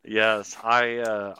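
ordinary talk, nothing marked

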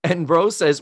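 usual speaking voice

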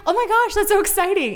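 high, gushing mock excitement; gushing [name]. Friend gushing at Betty's news, in a high mock squeal. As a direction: squeal it like a thrilled best friend.

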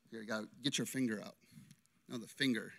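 usual speaking voice

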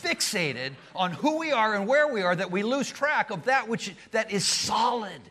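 loud, high, driving sermon voice; fiery pulpit proclamation; preaching at full force. Acted. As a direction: hammer the point home to the congregation.

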